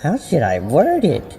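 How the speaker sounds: put-on young friend asking casually; teen girl voice. A narrator's reading. Bev's voice in story.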